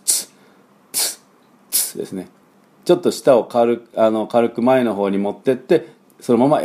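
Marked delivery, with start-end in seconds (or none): 0.0-2.3 ツ unvoiced, tongue forward; ts drill. Shown as a demonstration. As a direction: snap out ツ as one sound. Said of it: "ティーエスを同時に出します". (息だけで鋭くツ)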